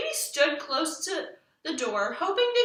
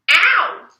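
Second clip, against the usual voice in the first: sudden ow; 5 semitones higher, 13 dB louder than usual. Acted. Burrs pulling her hair.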